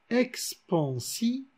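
"expansion" very slow and soft, ex-pan-si-on; diaeresis. Shown as a demonstration. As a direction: say "expansion" with a gap between "si" and "on". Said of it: "il se prononce".

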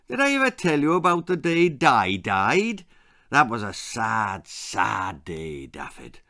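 granny grieving the day Di died, fading; sad reminiscing. A narrator's reading. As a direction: let it sink away sadly.

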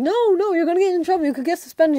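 alarmed warning. Retold or imitated. Friends warning a swearing classmate he'll be suspended, high and loud. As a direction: shriek the warning at him.

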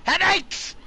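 high, loud silly shout; mock shout. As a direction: shout it back hammily. Mock shout of cousin's word.

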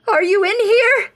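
shouting over the wind; screaming. Acted. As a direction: scream it into the storm. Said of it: "I screamed with a chattering jaw".

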